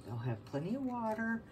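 usual speaking voice

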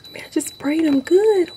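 normal talking voice

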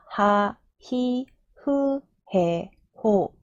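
하, 히, 흐, 해, 호 drawn out with breathed H onsets; syllable-by-syllable demo. Shown as a demonstration. Teacher showing the H row to learners, syllable-by-syllable demo. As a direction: recite 하, 히, 흐, 해, 호 slowly, each apart. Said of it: "H 발음이 붙어서".

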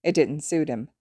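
plain reading voice

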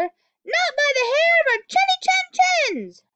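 high, loud little pig voices; three pigs refusing the wolf together, high and loud; pigs shouting together. Acted. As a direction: squeal it back like three tiny pigs. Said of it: "shouted all the pigs together".

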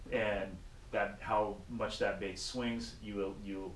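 ordinary talk, nothing marked

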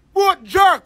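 ordinary talk, nothing marked

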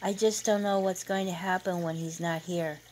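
plain reading voice

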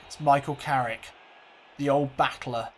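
ordinary delivery

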